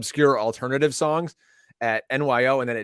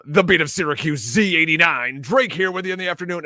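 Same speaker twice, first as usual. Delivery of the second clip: upbeat DJ patter, a bit louder; radio announcer voice; 4 semitones higher, 5 dB louder than usual. Retold or imitated. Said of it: "I put my radio announcer voice on".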